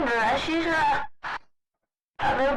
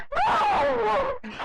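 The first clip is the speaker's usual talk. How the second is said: much higher yelled bro; high startled shout; shocked shout; 14 semitones higher than usual. Real, not acted. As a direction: yell it in disbelief. Shocked at another player.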